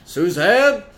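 loud, high call of Suzanne. Retold or imitated. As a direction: shout her name up the stairs. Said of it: "call out her name".

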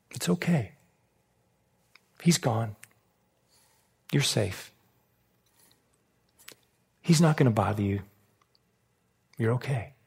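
soft, low, with long pauses; gentle reassurance. Retold or imitated. Hunter calming a frightened rabbit at his feet, softly reassuring. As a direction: soothe softly, letting each short phrase settle.

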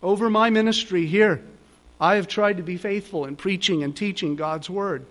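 ordinary talk

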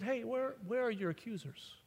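soft, hushed question; gentle Jesus. Acted. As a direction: ask it gently, almost under the breath. Jesus voiced gently in story.

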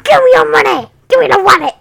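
loud, high mock shout; robber's demand. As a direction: bark it like a thief mid-holdup. Acting out robbers bursting in.

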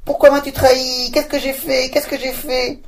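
high, loud wailing cry; witch's high shout, fading on the repeat. Acted. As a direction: shriek it as you chase the cat. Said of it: "en criant".

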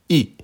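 I short, falling from high; fourth tone on I. Shown as a demonstration. Teacher showing learners Mandarin I, falling tone. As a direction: drop I sharply from the top. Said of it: "Quatrième ton".

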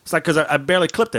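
usual speaking voice

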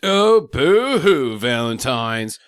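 slow, loud mock boo-hoo. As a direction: drag it out mockingly. Mocking Valentine's Day.

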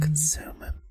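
soft eerie whisper; spooky whisper. Pretend. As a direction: hiss it like a ghost story. Pun on the spooky word.

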